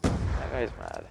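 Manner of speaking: slow low mocking drawl. Real, not acted. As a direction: deepen it to mock him. Mocking remark.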